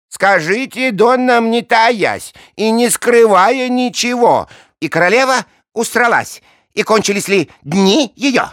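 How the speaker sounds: high, loud, eager questioning; eager king voice. Acted. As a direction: press him eagerly.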